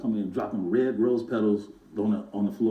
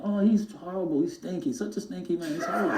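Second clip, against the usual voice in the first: lightly voiced, higher mock complaint; Michael complaining; 6 semitones higher than usual. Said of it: "I would complain to him".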